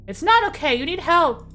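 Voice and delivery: urgent shouted protest; desperate plea. Acted. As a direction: beg him to get help. Voicing the heroine's plea.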